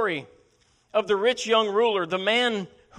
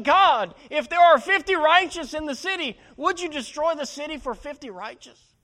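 urgent pleading prayer; 8 semitones higher, 5 dB louder than usual. Retold or imitated. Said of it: "he cried out in prayer, urgent supplication".

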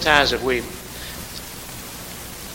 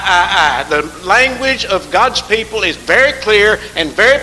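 much louder, higher, shouted sermon; fervent preaching; 4 semitones higher, 8 dB louder than usual. Acted. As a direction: cry it out to the whole church. Preaching at full force.

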